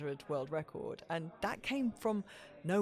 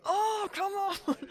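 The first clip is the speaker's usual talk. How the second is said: high, slow, strained plea, much higher; urging at the TV; 4 dB louder, much slower than usual. Retold or imitated. Fan yelling at the TV for Mo, high drawn-out plea. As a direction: drag it out like yelling at the screen. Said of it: "just just going".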